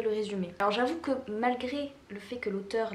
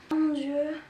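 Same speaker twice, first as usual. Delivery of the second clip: higher amazed "oh my God"; 5 semitones higher, slower than usual. Real, not acted. Opening a gift from a friend, amazed. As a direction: gasp of delighted surprise.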